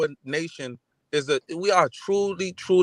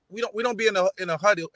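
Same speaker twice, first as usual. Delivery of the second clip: ordinary talk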